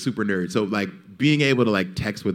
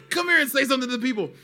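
put-on much higher, fast excited kid voice; high, fast childlike urging; kid's excited call; 14 semitones higher, much faster than usual. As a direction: rattle it off like an eager kid. Son's excited call retold.

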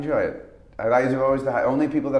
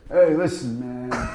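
loud laid-back "hey, listen, man"; a hint of Matt Dillon's drawl; Matt Dillon impression; 5 semitones higher, 4 dB louder, slower than usual. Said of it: "my impression of Matt".

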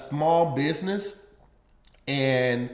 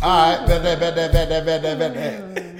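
robot talking slang; 5 dB louder than usual. Acted. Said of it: "To hear robots say".

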